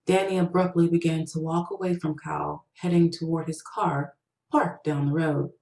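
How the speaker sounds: ordinary delivery, nothing marked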